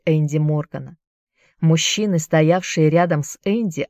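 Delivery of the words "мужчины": ordinary delivery, nothing marked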